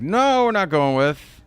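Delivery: high, loud, drawn-out no; exaggerated high-pitched refusal; mock dad refusal. Acting out the dad duck refusing.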